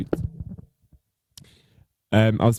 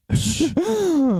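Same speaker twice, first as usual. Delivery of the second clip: shrieked exclamation. Real, not acted. Reacting to juicy drag gossip.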